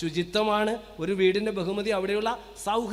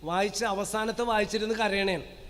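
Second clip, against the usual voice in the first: ordinary delivery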